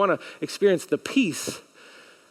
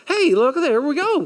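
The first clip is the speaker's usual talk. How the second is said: excited showing; 9 semitones higher, 5 dB louder than usual. Real, not acted. Demonstrating with props, excited.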